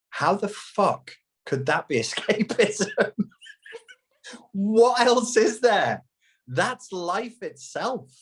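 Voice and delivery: high, slow disbelief at how absurd it is; stunned, can't believe the question; high disbelieving exclamation; incredulous. Real, not acted.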